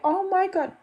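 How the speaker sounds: high-pitched mock shock, hand to chest; a mother's outraged, disbelieving cry; shocked disbelief. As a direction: squeal it up high as if you can't believe it. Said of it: "I'm like".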